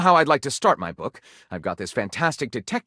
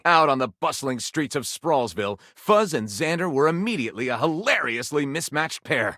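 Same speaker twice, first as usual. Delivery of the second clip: ordinary talk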